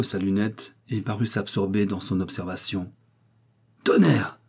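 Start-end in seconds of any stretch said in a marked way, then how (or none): 3.8-4.4 loud, higher alarmed exclamation; startled sailor's roar; sudden roar. A narrator's reading. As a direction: bellow it out suddenly. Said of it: "rugit-il tout à coup".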